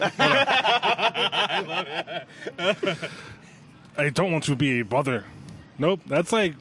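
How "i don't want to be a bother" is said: Russian slipping toward German; badly faked Russian accent; botched Russian accent. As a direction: attempt Russian, land near German. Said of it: "like, German more than anything".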